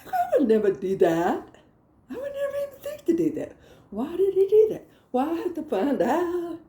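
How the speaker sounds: high, squawking mock outrage; hammed-up wounded indignation; indignant protest. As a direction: protest innocence, shooting up in pitch. Mock protest at dad's warning.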